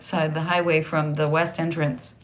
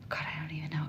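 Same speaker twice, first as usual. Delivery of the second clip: whispering; 15 dB quieter, much faster than usual. Real, not acted. Hushed aside, unsure.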